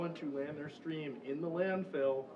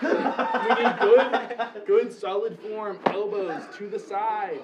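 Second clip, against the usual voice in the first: coach shouting; 4 semitones higher, 9 dB louder, slower than usual. Pretend. Coach calling out form.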